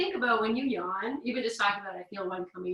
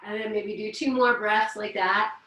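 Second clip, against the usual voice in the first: calm, unhurried instructor voice leading breaths; guided breathing. Acted. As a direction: guide the class gently through the yawn.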